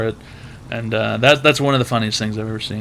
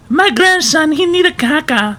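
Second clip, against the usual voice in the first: much higher, broken-English Eastern European granny voice; Eastern European granny accent; 14 semitones higher, 3 dB louder than usual. Retold or imitated. Grocery worker mimics a babushka asking for the toilet, high Eastern European accent. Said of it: "like a Eastern European woman".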